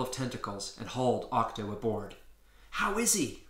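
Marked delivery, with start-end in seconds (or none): 2.7-3.5 mild yell. Acted. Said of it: "I yelled from the cockpit".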